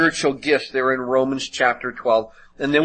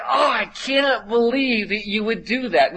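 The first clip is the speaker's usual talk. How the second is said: higher-pitched indignant shout; outraged shout; 8 semitones higher than usual. Retold or imitated. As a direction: shout in disbelief at the sibling who snitched. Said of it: "They're like".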